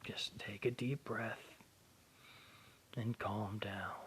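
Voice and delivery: put-on soothing a grieving boy in a hushed voice; soft calming whisper. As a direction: talk him down gently, almost whispering. Father figure calming grieving boy.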